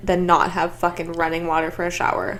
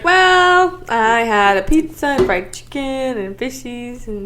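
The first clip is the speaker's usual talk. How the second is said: mock excuse voice; 4 semitones higher, 4 dB louder, slower than usual. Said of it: "you're like".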